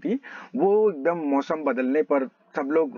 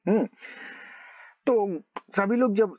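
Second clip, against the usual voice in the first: ordinary talk, nothing marked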